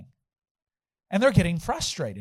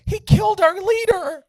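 high, louder mock outcry; panicked accusation; 11 semitones higher, 5 dB louder than usual. Assistant to the police, high alarmed cry. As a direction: imitate an alarmed follower accusing someone.

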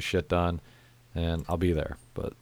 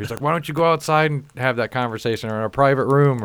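scolding coworker; 6 semitones higher, 4 dB louder than usual. Retold or imitated.

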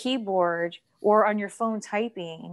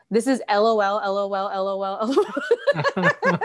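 laughing; 4 dB louder than usual. Real, not acted. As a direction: spell it out while cracking up. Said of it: "as I was laughing".

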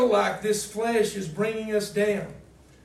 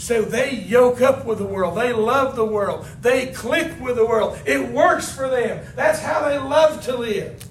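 loud preaching; 3 semitones higher, 6 dB louder than usual. Acted. Preaching hard to the congregation.

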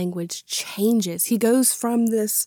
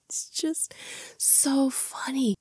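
whispered; 5 semitones higher, 6 dB quieter, slower than usual. Real, not acted. Amused whisper after laughing.

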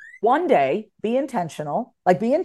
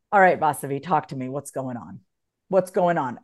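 calm self check-in; 8 semitones lower than usual. Shown as a demonstration. To herself in the car when overwhelmed, calm. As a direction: talk yourself down gently. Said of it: "I'll take a deep breath and I go".

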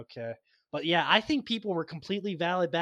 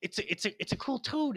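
excited; 5 semitones higher, 5 dB louder than usual. Retold or imitated. Excited kid spotting a toad.

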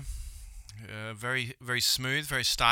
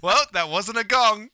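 higher, louder, slow; loud punchline; 7 semitones higher, 8 dB louder than usual. Acted. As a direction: bellow the punchline. Joke punchline.

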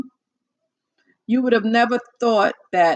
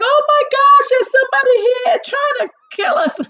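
high, loud mock panic on the radio; panicked shriek; 7 dB louder than usual. Imitating a pilot who panics mid-flight, loud and high. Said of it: "he wasn't like".

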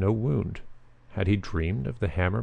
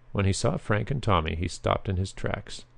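usual speaking voice

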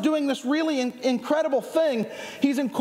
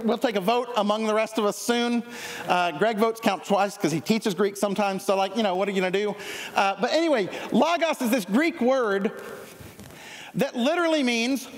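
ordinary delivery

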